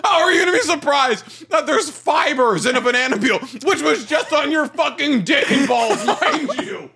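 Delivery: high, laughing through the words; can't stop cracking up; laughing while talking. Real, not acted. Cohost laughing while roasting him over the banana peel.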